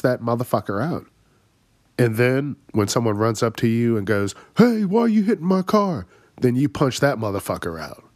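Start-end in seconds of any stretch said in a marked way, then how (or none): 4.6-6.1 mock outraged owner. Owner confronting window breaker.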